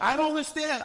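panicked cry of confusion; frantic exclaim. Retold or imitated. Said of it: "I'm like".

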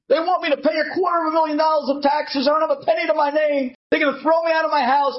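high, rushed begging that ends in a shout; terrified man begging the Rebbe, fast and high; desperate pleading. Acted. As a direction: plead frantically for rescue.

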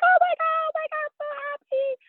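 high-pitched, overjoyed squeal imitating mom; excited mother. Mother thrilled by daughter's pregnancy news, imitated excited squeal. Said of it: "she starts being so excited".

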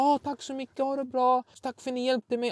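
high, polite imitation of her thanks; suddenly normal woman. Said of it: "hon blir en vanlig människa igen".

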